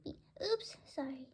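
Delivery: ordinary delivery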